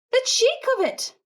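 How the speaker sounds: high indignant exclamation. A narrator's reading. Jonathan outraged at man eating sandwiches, high indignant. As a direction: huff it out, outraged.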